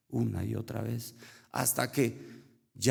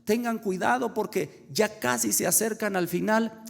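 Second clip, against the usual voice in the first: high-pitched, urgent warning call; imitando grito lejano; 10 semitones higher, 3 dB louder than usual. Retold or imitated. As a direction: call out a warning to boys in the distance, half-shouted. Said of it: "les gritaba desde lejos". (grito de advertencia imitado, agudo)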